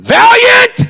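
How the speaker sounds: calling out. Retold or imitated. Mother yelling to her son from bed, loud call. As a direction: yell the name down the hall.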